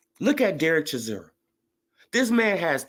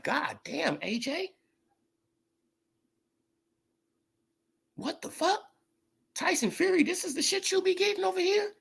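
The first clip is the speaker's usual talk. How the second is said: lightly voiced Wilder, much higher and softer; awed Deontay Wilder; 4 dB quieter than usual. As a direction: mimic a boxer stunned by the crowd's love, higher and hushed. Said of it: "He like".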